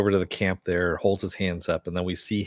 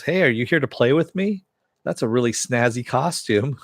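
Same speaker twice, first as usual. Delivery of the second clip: high, sweet, playful doll voice, pitched way up; a childlike dummy inviting someone to play; creepy talking doll; 5 semitones higher, 3 dB louder than usual. Retold or imitated. A dummy greets the beard hunter in a high, playful voice. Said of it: "it talks and it goes".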